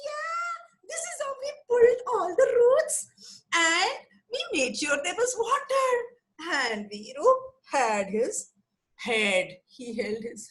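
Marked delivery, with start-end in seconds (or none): 0.1-5.9 much higher, small and proud; little monkey voice. Acted. Little monkey explains to Viru, high and proud. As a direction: chirp it like a tiny helper. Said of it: "the little monkey said".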